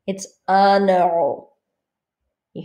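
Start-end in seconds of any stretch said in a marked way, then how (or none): 0.1-1.5 it's un euro, N linked into euro; liaison. Shown as a demonstration. Teacher repeating the liaison example. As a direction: link un to euro with an N. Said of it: "here we hear the N".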